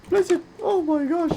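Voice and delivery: high frantic shriek of panic; panicked shriek. Real, not acted. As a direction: yelp in sudden fright. Falling into danger.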